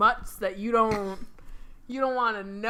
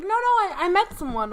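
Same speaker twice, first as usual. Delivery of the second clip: high, loud flustered denial; acted quick protest; 8 semitones higher, 6 dB louder than usual. Sally protesting to the employee, acted.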